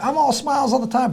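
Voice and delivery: high, cheerful imitated voice; all smiles. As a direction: chirp it with a big smile. Imitating friend's cheerful self-description.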